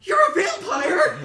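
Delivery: shrieking accusation. Acted. NPC women accusing each other.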